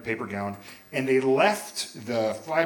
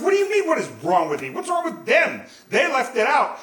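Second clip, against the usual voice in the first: high, indignant protest; 11 semitones higher, 5 dB louder than usual. Retold or imitated. Teenager defending himself to his dad, indignant. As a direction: throw the blame onto them, voice climbing. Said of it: "some indignant shit like that".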